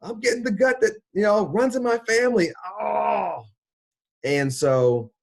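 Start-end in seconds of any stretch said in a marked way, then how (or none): 0.0-3.4 very high, loud horrified gasp and wail; shocked cry. Retold or imitated. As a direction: gasp at the photo in real horror, voice cracking high. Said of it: "I was like".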